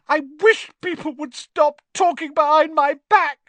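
high, loud tearful wail; hammed-up weepy ghost girl; choked with tears. A narrator's reading. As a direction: wail the complaint as if about to sob. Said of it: "in a voice choked with tears".

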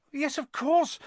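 high-pitched, flustered exclamation; crying out. A narrator's reading. Girl frantic to save her friends, crying out. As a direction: realise it in a panic. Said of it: "Hermione cried".

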